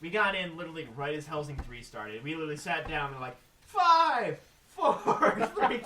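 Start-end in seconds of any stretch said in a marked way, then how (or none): 3.7-5.8 loud, high imitated countdown; shouted countdown. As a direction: call out the numbers like an event countdown. Acting out a countdown.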